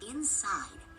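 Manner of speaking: usual speaking voice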